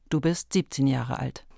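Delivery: ordinary delivery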